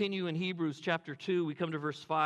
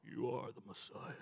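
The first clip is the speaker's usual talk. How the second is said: movie trailer voice; 6 semitones lower, 10 dB quieter than usual. Pretend. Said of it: "the guy that does the movie trailers".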